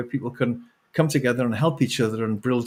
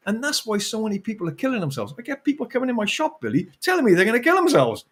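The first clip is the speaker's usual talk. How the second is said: ordinary delivery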